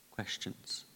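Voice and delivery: ordinary talk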